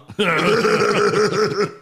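wheezy Seth Rogen laugh. Pretend. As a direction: do the Rogen chuckle.

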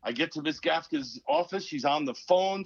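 usual speaking voice

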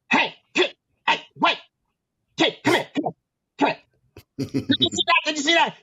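high squeaky villain impression; Penguin voice. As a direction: imitate the coach doing a Penguin-from-Batman voice, calling the kid over. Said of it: "he spoke like the Penguin".

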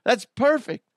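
high, delighted gushing; excited gushing. Retold or imitated. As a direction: gush with thrilled approval. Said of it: "I'm like".

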